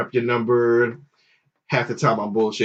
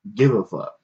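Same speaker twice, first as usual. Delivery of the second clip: ordinary talk, nothing marked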